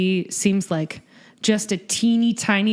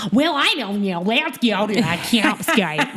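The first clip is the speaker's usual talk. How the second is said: bad American accent. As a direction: do a hammed-up, terrible American accent. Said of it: "It's really bad".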